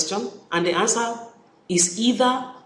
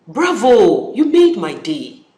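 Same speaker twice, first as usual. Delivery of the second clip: excited praise; 3 semitones higher, 7 dB louder, slower than usual. Shown as a demonstration. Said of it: "number two".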